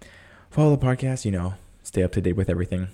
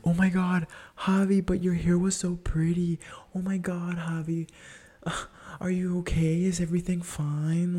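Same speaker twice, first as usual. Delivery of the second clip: fan reaction; 9 semitones higher than usual. Retold or imitated. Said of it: "It's like".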